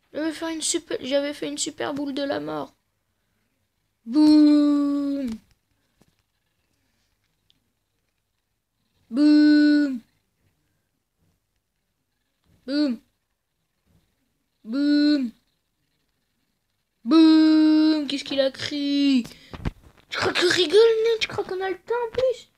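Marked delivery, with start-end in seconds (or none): 4.0-18.1 loud booming yells; excited shouts. Real, not acted. Gamer cheers his special attacks, loud booming shouts. Celebrating big attacks in game. 20.1-22.5 high, loud taunting yell; heated taunt. Real, not acted. Gamer taunts the enemy fighter, heated yelling.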